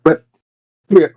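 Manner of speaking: ordinary talk, nothing marked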